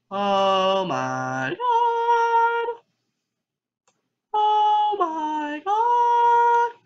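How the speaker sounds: very slow, drawn-out sung exclamation; hammed-up alarm. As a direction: stretch each word, leaping into a high wail. Reacting to creepy text on screen.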